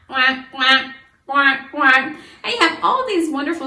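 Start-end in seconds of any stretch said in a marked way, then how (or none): 0.1-2.1 louder playful quacking; duck quack. Shown as a demonstration. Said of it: "the duck likes to quack".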